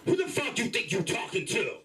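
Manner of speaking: high, put-on street voice, mock-angry; angry project speak. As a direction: snap back as if someone crossed the line. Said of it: "when I get angry".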